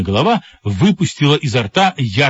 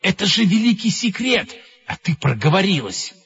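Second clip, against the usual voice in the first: hissing reproach; 8 semitones higher than usual. A narrator's reading. One head scolding another over a secret, hissing reproach. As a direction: chide a blabbermouth in a put-on voice. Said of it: "зашипела на синюю голову голубая".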